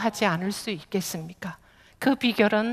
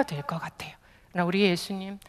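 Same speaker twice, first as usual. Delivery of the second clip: whisper; 4 semitones lower, 7 dB quieter, faster than usual. Real, not acted. Sermon aside, sudden hush.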